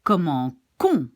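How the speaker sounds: "comment qu'on" with a k starting the last syllable; hard C. Shown as a demonstration. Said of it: "I should pronounce".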